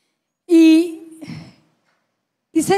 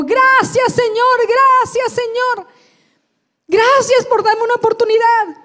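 grito de gratitud; 6 semitones higher than usual. Retold or imitated. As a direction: call out thanks to the Lord, drawn out. Repeats her cry of thanks. (clamando gracias al Señor, con voz alta)

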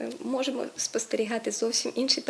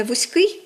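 вузький pushed loud on the final -ий; stress on ending; 3 semitones higher, 7 dB louder than usual. Shown as a demonstration. Teacher models the adjective, вузький stressed on the ending. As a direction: hit the last syllable of вузький. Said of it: "ми ставимо прикметників".

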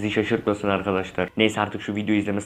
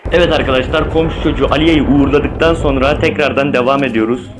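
loud, upbeat gamer hype; hyped youtuber voice; 4 semitones higher, 5 dB louder than usual. Acted. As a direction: pump it up for viewers. (coşkulu yüksek sesle)

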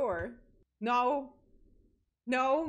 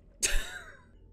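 high squeal of a laugh; shrill laugh; 6 dB louder than usual. Real, not acted. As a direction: burst out in a high, loud laugh.

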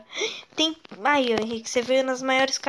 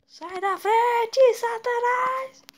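high, slow drawn-out yell; mock exorcist cry; 10 semitones higher, 3 dB louder, much slower than usual. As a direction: drag it out like a preacher casting out. Running over enemy with car. (grito teatral e arrastado)

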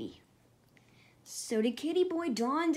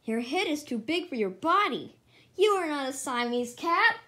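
usual speaking voice

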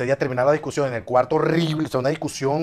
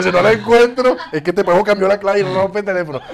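higher, louder panicked inner voice; panicked thought; 7 semitones higher, 9 dB louder than usual. Retold or imitated. Worried he can't find her, panicked and higher. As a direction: voice the panic, pitched up.

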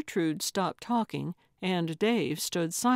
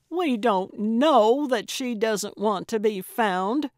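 raised, louder exasperated protest; frustrated cry; 4 semitones higher, 6 dB louder than usual. A narrator's reading. Gertrude protests to Dave about Talia, beyond frustrated. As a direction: cry out in frustration, high and loud. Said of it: "Gertrude cried, beyond frustrated".

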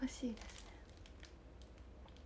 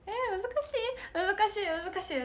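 much higher whining; whiny complaint; 9 semitones higher than usual. Real, not acted. Whining at hard request.

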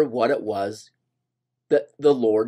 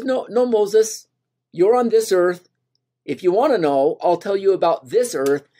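the Lord correcting; 7 semitones higher, 3 dB louder than usual. Acted. The Lord redirects Moses, patient and gentle. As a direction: rein Moses in kindly.

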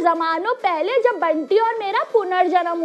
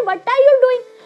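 louder, exasperated demand; 5 semitones higher, 5 dB louder, much slower than usual. Retold or imitated. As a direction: complain to God like he messed up. Complaining to God.

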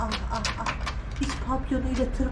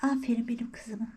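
soft whispered praise; 4 semitones lower, 7 dB quieter than usual. Real, not acted. Owner whispering praise to her sleepy cat. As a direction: hush it to the cat.

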